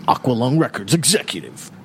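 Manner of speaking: ordinary delivery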